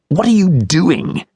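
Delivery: high, slow, accusing question; the offended man glaring back at Dad; indignant stranger. A narrator's reading. Guy confronts Dad again.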